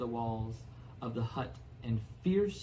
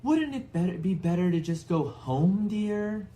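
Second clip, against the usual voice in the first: high, pleading wife's voice; pitched-up timid suggestion to husband; timid Aunt Petunia; 7 semitones higher, 7 dB louder than usual. Acted. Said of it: "Aunt Petunia suggested timidly".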